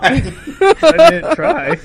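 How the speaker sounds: slow words through laughter; laughing. Real, not acted. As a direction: laugh while denying it. Accused of snorting, laughing.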